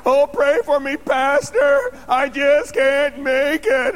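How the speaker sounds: high, loud mock wail; mock a helpless member begging the pastor; whining churchgoer. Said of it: "So don't come up to me".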